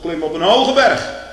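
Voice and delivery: preacher crying out scripture, full force; ringing proclamation. Acted. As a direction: declaim it like a herald on a mountain.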